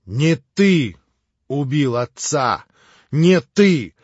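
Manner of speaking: громко, с нажимом на «убил»; отчекань каждое слово; твёрдо. A narrator's reading. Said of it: "твёрдо повторил Алёша". (твёрдое, громкое повторение)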